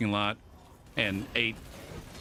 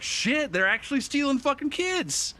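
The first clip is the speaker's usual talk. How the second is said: much higher, shocked outburst; alarmed realization; 13 semitones higher than usual. Retold or imitated. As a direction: blurt it in shocked disbelief. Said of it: "And we're like".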